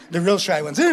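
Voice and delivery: ordinary talk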